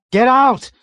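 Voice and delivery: very high, very loud hysterical yell. A narrator's reading. Terrified pilot yells hysterically at navigator. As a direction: scream it out of control. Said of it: "Yossarian yelled hysterically".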